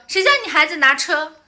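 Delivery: loud, high snappy retort; the mother hitting back in the quarrel; imitating the other mother. 吵架中模仿对方妈妈. (模仿对方妈妈大声顶嘴)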